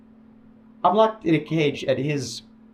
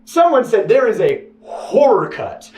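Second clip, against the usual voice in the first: ordinary talk, nothing marked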